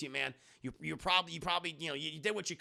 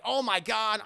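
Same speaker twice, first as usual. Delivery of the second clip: higher, louder mock gushing; mock excitement; 5 semitones higher, 6 dB louder than usual. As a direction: act out a character's excited outburst. Voicing excited movie character.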